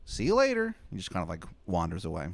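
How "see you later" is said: very high, loud, quick falsetto; Shithead Steve asking. Acted. Steve asking Adam for a favor, fast high falsetto. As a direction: rush the request up high. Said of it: "And he's like".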